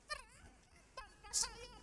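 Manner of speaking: high, slow, tearful wail; mock crying. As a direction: cry it out like someone left behind. Acted wail left behind.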